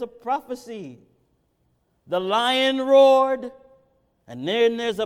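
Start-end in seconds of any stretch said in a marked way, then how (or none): 2.1-3.5 high, loud pulpit preaching; rising fervent sermon voice; preaching at full force. Acted. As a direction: preach it out, pleading with the crowd. Preaching climax.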